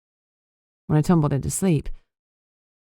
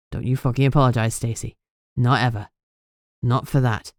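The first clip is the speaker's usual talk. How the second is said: put-on lower, quick, firm boyfriend; fierce insistence; 5 semitones lower than usual. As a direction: refuse her apology, hard and fast.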